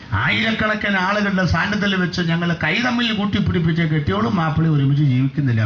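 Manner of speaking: normal talking voice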